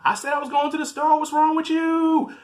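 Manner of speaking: high mock-indignant yelling; hammed-up squabbling in a very high voice; arguing girlfriend. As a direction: play her exasperated comeback, stretched out at the end. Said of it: "they start arguing again".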